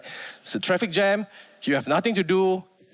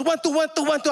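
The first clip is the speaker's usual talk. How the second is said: high, quick drill counting; insistent fast count; 8 semitones higher, faster than usual. Retold or imitated. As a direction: count rapidly to push a lagging rider. Teacher pushes the lagging rider.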